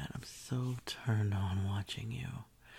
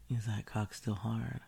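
ordinary talk, nothing marked

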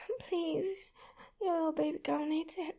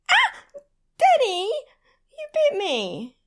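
loud high yelp, then slow protest; startled yelp; 9 semitones higher, 13 dB louder, much slower than usual. Acted. Protesting loudly to her partner after a sudden bite. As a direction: shriek at the surprise bite. Bitten unexpectedly.

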